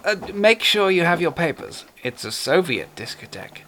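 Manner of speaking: lightly voiced suave villain; a hint of a genteel British horror actor; Peter Cushing voice. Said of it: "Peter Cushing as Major Benedek".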